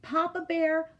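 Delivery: usual speaking voice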